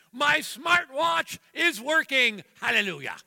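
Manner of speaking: joyful shout of victory; triumphant. Real, not acted. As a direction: proclaim it like a miracle. Triumphant punchline in sermon.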